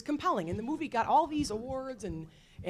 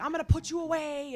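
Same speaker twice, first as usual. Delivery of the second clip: raised-pitch, hammed-up confrontation line; dramatic movie threat; 8 semitones higher, 4 dB louder than usual. Lecturer imitating Ness confronting Capone, very dramatic. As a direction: declare it like a climactic movie showdown. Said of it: "very dramatically".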